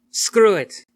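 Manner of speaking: loud, high shout; frustrated yell. Acted. Rain giving up on the window, shouting. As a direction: blurt it out at the top of the lungs. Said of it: "Rain yelling in frustration".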